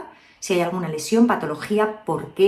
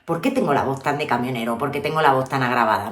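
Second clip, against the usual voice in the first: much lower, heavy trucker-like voice; imitating a deep-voiced woman; 7 semitones lower than usual. Coach imitating women who complain of a trucker voice, much lower. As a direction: drop the voice low like a complaining client. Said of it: "hay mucha gente que dice".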